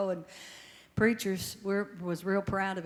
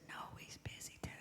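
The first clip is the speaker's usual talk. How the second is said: whisper; 17 dB quieter than usual. Retold or imitated. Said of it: "he whispered when he answered".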